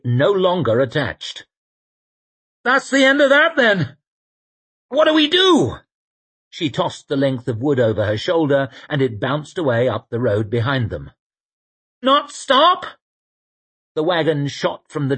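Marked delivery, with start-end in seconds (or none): 2.6-5.8 high, loud, panicked yell; alarmed shout. Acted. Shai yells on runaway wagon. 12.0-12.9 reckless woman's voice. Acted. Shai answers while driving wildly.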